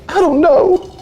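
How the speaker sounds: loud, very high-pitched mock wail; hammed-up kid exasperation; moody daughter. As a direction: imitate a girl in a bad mood. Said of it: "She's in a bad mood".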